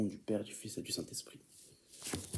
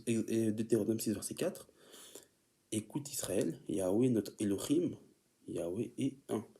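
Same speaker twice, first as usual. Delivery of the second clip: ordinary talk, nothing marked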